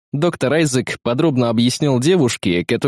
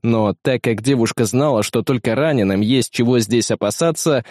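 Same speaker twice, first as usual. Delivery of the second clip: ordinary delivery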